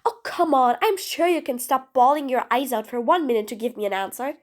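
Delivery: ordinary talk, nothing marked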